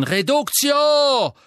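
high, loud, drawn-out spell; roar. Acted. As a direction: bellow the curse together. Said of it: "brüllten".

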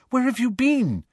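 worried friend springing up to greet him; anxious. A narrator's reading. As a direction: fret over him as he walks in. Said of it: "said Hermione anxiously".

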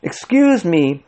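high, slow, refined address; cultured voice. A narrator's reading. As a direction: interject with cultivated politeness. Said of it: "in a cultured voice".

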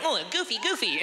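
put-on high, silly child voice; high-pitched goofing for the camera; goofy kid. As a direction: clown around like a kid. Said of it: "When I was a kid".

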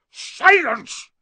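explosive high bellow; furious full-force shout; yell. A narrator's reading. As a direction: silence the boy with one yell. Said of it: "yelled Uncle Vernon".

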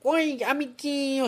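high, slow squeaky youtuber greeting; bright squeaky sing-song welcome. Retold or imitated. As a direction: stretch it out in a chirpy kid-host voice. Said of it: "eu já tô imitando o Felipe Neto".

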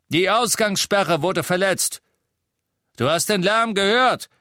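loud, high official charge. A narrator's reading. Death Eater citing the broken curfew, loud reproach. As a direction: state the charge loudly.

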